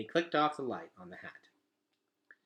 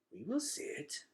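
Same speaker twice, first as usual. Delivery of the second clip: stiffly; 7 semitones higher, 7 dB quieter, slower than usual. Acted. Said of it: "said one stiffly".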